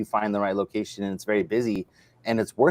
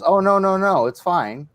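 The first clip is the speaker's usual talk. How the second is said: higher, louder hasty mock backpedal; flustered brush-off; 8 semitones higher, 5 dB louder than usual. Shop owner backing off the electricity question, hasty higher brush-off. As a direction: quickly wave it away, a bit flustered.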